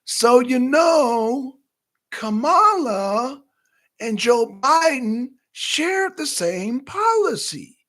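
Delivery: high, slow, drawn-out put-on politician voice; Nikki Haley impression. Vlogger mocks a candidate's talking points, high and slow. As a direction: stretch each word out like a careful candidate. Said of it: "I'm gonna say it in my Nikki Haley voice".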